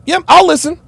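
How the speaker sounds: high, smiley mock agreement; smiling imitation. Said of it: "look at the smile".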